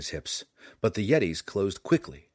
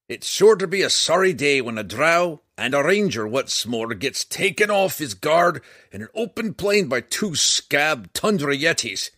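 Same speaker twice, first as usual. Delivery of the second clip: scornful dwarf, much higher and louder; gruff gravelly dwarf voice; 7 semitones higher, 8 dB louder than usual. A narrator's reading. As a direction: lecture him like an indignant old dwarf. Dwarf Bruenor scolds Drizzt.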